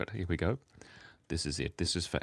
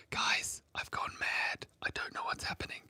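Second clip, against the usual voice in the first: whisper; 6 dB quieter than usual. Real, not acted. Giggly confession to viewers.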